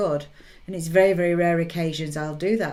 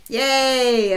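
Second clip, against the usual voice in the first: cheer; 6 semitones higher, 6 dB louder than usual. Real, not acted. Cheer after birthday song.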